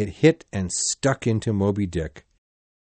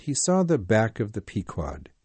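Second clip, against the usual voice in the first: plain reading voice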